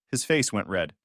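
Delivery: plain reading voice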